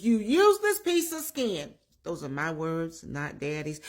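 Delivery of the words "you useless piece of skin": mildly angry mock dad, pitched up; retold tirade. Scolding dad to daughter's boyfriend, retold angry outburst. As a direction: imitate a father letting loose. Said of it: "Daddy let loose".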